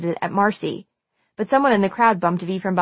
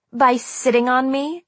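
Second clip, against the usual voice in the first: slow, higher-pitched mocking question; sneering; 5 semitones higher than usual. A narrator's reading. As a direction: draw out the insult as a question. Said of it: "Marcy sneered".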